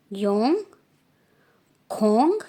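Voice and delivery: loud, rising योंग and aspirated खोंग; careful Korean pronunciation. Shown as a demonstration. Teacher repeats numbers so students copy the sounds, loud and clear. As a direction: stress the breathy kh in खोंग. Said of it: "pronunciation मैं कैसे कर रही हूँ उस पर ध्यान देना".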